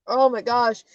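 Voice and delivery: normal talking voice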